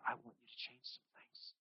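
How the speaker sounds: a whispered gentle urging; Jesus whispering a call to change. Retold or imitated. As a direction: whisper it kindly.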